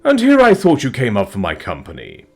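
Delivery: pleased dragon in mock offence. Dragon voiced in story.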